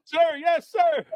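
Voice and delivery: high, loud yelled reply; recruit shout. Retold or imitated. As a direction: scream it like a recruit. Recruits answering sergeant.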